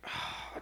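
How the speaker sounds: voiceless, very soft weary sigh; exasperated sigh. Retold or imitated. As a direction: let the air out, worn down. Exasperated sigh in retold moment.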